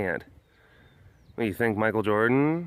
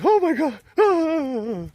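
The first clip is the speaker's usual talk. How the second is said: mock fangirl squeal; 4 dB louder, slower than usual. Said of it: "are just like".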